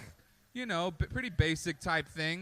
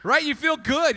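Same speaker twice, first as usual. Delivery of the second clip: ordinary talk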